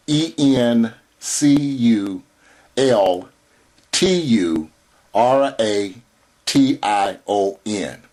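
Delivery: slow, deliberate letter by letter; spelling it out. Real, not acted. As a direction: spell it out in small groups, pausing between them. Spelling a term for students.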